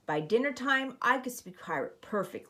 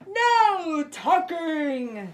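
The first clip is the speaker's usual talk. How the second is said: higher, louder, very slow crew cry; 9 semitones higher, 6 dB louder, much slower than usual. Acted. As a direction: drag it out as a rowdy chorus. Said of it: "the crew cried".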